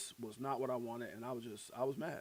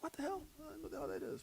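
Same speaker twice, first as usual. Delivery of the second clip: very high, softer mock exasperation; retold bafflement; 13 semitones higher, 13 dB quieter, faster than usual. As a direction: squeal it under your breath in disbelief. Retold baffled reaction to wife.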